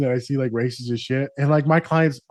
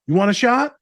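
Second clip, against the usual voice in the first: high, loud offer of a shot; best friend offering; 9 semitones higher, 6 dB louder than usual. Retold or imitated. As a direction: pitch it high and loud, dodging. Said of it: "He's like".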